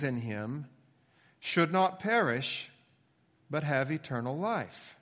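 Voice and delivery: scripture reading. Acted. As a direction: proclaim the verse phrase by phrase, with long pauses. Reads the key verse aloud.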